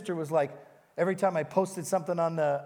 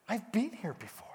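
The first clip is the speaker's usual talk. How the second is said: hushed, unvoiced inner realization; preacher whispering his own flash of recognition; whispered thought; 2 semitones higher, 6 dB quieter than usual. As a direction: whisper it as a sudden memory. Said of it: "I had this thought".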